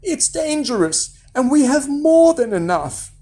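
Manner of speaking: high, worried mother; anxious motherly plea; begging. A narrator's reading. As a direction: warn your son, pitched high. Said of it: "begged his mother".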